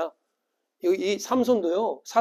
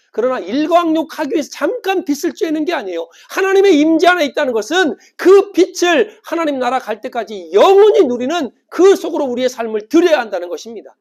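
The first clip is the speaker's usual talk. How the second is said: raised pitch, loud fervent preacher; impassioned preaching; 7 semitones higher, 9 dB louder than usual. Real, not acted. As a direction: preach it out at full force. Preaches at full force. (힘차게 외치는 설교)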